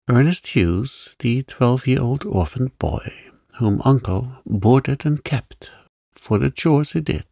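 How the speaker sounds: plain reading voice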